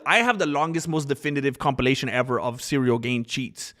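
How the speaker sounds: ordinary delivery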